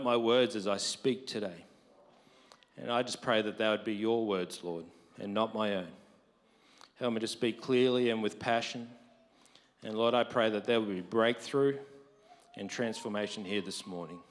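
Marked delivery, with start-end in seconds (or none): none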